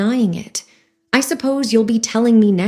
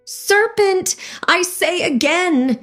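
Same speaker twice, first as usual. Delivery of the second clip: much higher shrill repeat; shrieking pigeon; 10 semitones higher, slower than usual. A narrator's reading. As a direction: shriek the word at her again. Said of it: "repeated the pigeon".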